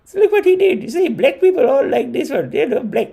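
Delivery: gushing mom pointing things out; imitating mom. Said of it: "That's how she talks about it".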